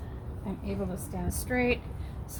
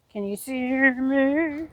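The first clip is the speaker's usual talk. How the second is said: louder and slower, calling across the yard; 3 semitones higher, 8 dB louder, slower than usual. Real, not acted. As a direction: stretch it out so they hear you far away. Calling out to someone far.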